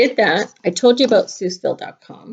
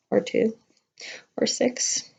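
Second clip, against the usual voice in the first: playful whisper; 7 dB quieter than usual. Acted. Joking aside about hats made.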